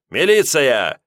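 громкий высокий окрик; рявкнуть приказ; крик. A narrator's reading. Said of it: "заорал Леонтий". (Леонтий орёт на карманника)

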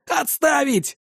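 high, urgent exclamation; exclaimed order. A narrator's reading. Engineer halting the armed terrorists, exclaiming. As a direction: cry out to halt them, high-pitched. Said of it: "воскликнул один из инженеров".

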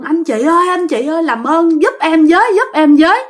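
high-pitched pleading call; calling for help. Retold or imitated. Girl begging nearby households for help, calling out. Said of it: "Nó kêu quá trời luôn".

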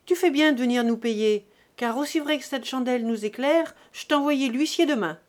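loud, hard threat; brutal, out loud. A narrator's reading. Father threatening his son with the bailiff, loud and harsh. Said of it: "Puis, tout haut, brutal".

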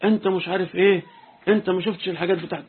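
angry Egyptian man shouting over his seat; retold yelling. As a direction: yell about his stolen chair, partly acted. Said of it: "الراجل المصري زعق له".

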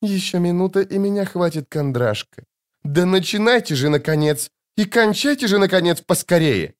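high, urgent seething; hissed impatience. A narrator's reading. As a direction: urge them on through a forced smile. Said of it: "прошипела Йеннифер сквозь мило улыбающиеся губы".

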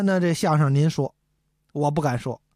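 ordinary delivery

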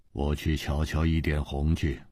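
quiet, low character voice; low-key Ji Bingyan. A narrator's reading. Ji Bingyan quietly tells companions he will go looking, low and understated.

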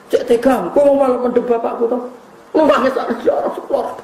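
high, mock-indignant reproach with a laugh at the end; Fatimah. Daughter reproaches Anas for burying her father, mock-indignant. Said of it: "Bareng diceweluk Sayyidah Fatimah".

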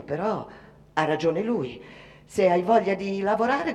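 usual speaking voice

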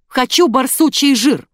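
loud, high, slow insistent demand; barked demand. A narrator's reading. Rude customer demanding badger fat, barking. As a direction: bark out each word. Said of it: "рявкнула мадам".

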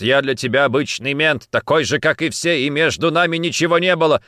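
loud, rising indignation; heated accusation; hurt reproach. Acted. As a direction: throw it at her, offended. Wounded lover's reproach.

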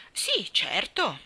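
ordinary delivery

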